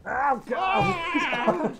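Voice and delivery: high shocked yelp, then laughing; shocked cry. Real, not acted. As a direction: let out a startled shriek. Sudden scream.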